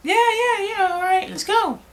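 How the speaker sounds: mocking high-pitched teenager; dumb teen voice. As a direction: parody eager kids cheering on. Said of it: "That sounds like fun, right?".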